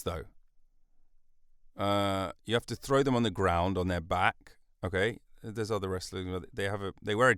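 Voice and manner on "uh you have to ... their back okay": ordinary talk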